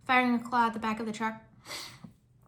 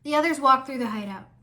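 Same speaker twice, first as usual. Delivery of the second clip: usual speaking voice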